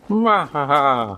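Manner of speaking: slow hammy villain cackle; a mock mad scientist laugh; evil laugh. Villain laugh after taunt.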